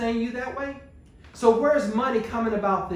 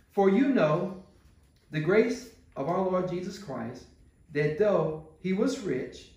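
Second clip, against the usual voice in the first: scripture reading; 3 semitones lower than usual. Acted. Reads scripture verse aloud.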